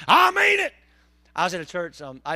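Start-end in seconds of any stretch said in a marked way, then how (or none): 0.1-0.7 loud, high-pitched mock threat; mock warning shout. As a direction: belt it out at the devil in play.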